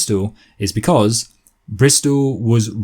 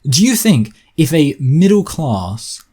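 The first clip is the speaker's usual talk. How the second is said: ordinary talk, nothing marked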